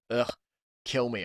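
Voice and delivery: ordinary delivery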